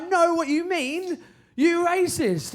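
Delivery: high, slow accusing call-out; indignant retort. Retold or imitated. Comedian answering coded remarks from locals, indignant.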